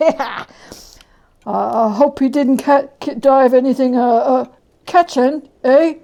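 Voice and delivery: a greedy man suddenly afraid of infection; loud halting anxious question; nervous old Joe. Acted. Joe asks nervously about disease.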